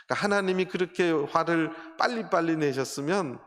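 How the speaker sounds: usual speaking voice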